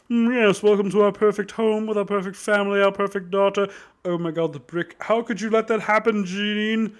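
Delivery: high, put-on voice of a snooty host; super rich parents. Rich parent fretting to Janine over the brick, high mock-posh voice. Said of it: "super rich parents".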